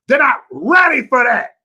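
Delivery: loud, high exasperated yell; heated shout. Real, not acted. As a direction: yell it out in frustration. Heated rant about timid leaders.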